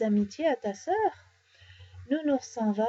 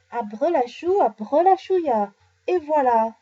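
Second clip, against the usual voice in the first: loud, raised magic spell; higher, louder magician's flourish; triumphant incantation; 3 semitones higher, 6 dB louder than usual. A narrator's reading. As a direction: draw out the spell, then present it proudly. Girl proudly casts the spell.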